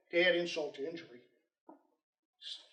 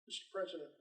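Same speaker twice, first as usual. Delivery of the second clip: quiet; 10 dB quieter, much faster than usual. Retold or imitated. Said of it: "very quiet".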